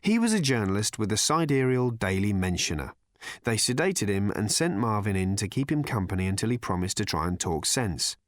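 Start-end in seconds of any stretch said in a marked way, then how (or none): none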